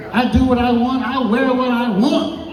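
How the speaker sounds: high, drawn-out exultant declaration; mock triumphant freedom. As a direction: crow it high, holding the last words. Newly divorced, declaring his freedom.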